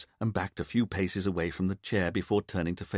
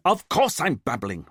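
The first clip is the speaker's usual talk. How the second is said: exploding; 5 semitones higher, 6 dB louder than usual. A narrator's reading. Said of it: "Richard exploded".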